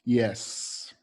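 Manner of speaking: a pupil answering yes; student's answer. Shown as a demonstration. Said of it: "What will the kid say?".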